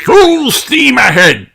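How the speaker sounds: loud, slow, high pirate roar; booming captain's cry. A narrator's reading. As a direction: bellow the order like a pirate captain. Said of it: "roars Redbeard".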